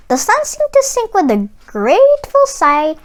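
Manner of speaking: ordinary delivery, nothing marked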